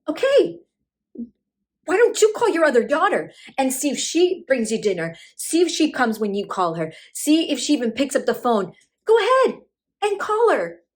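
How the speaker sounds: loud, high, climbing outburst; acted angry retort. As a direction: dare her, building to a high shout. Daughter storms back at mother.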